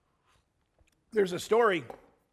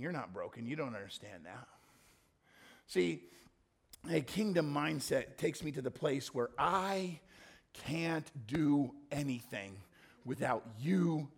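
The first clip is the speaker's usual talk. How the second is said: ordinary delivery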